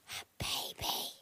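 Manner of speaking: hushed whisper. Real, not acted. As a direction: breathe it so nobody wakes. Hushing so Fluffy can rest.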